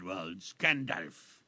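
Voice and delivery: imitating Tolkien's Oxford-South African accent; a light touch of Tolkien's Oxford English; Oxfordian South African accent. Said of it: "in his very heavy, Oxfordian cum South African, uh, accent".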